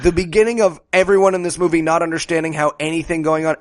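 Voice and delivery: ordinary delivery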